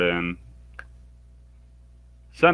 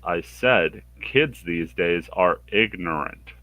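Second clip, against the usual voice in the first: slow deliberate repeat; slower than usual. Acted. Samurai repeats his insult slowly.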